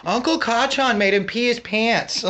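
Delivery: put-on high little girl tattling; a small girl telling on her uncle. Daughter's chat bubble.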